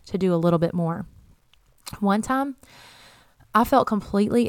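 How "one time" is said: usual speaking voice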